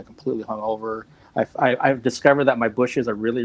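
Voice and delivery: usual speaking voice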